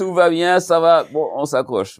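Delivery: high, breezy imitation of reassuring the parents; acted-out brave front on the phone; mock reassurance. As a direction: play at insisting all is well.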